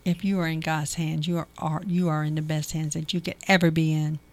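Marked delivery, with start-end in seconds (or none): none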